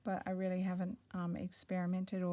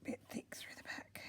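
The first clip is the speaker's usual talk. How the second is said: whispering; 10 dB quieter than usual. Real, not acted. Quietly working on embroidery.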